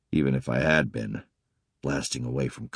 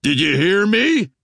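angry snap; 8 dB louder than usual. Acted. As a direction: bark it with anger in a bass voice. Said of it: "I snapped, putting some anger into my voice".

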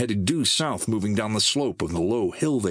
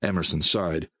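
ordinary delivery, nothing marked

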